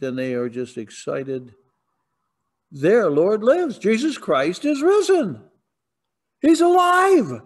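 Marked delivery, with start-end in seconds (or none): 2.7-7.4 joyful proclamation. Real, not acted.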